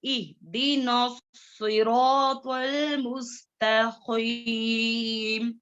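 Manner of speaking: very slow, drawn-out Quran recitation. Shown as a demonstration. Teacher giving the whole verse for pupils to copy. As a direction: recite slowly and fully. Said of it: "सीन और सौद".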